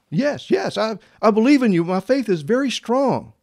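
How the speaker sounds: high-pitched, eager pleading voice; man hanging on. Acted. Hanging man assuring God of his faith, high and eager. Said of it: "He began to yell for help".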